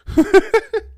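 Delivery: squealing high laugh; a sudden high-pitched cackle at himself; high giggle. Real, not acted. Laughing at his own tangent.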